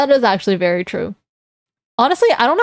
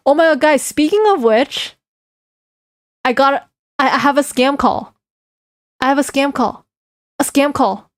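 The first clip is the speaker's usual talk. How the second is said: excited; 4 semitones higher than usual. Real, not acted. Scam call arriving now.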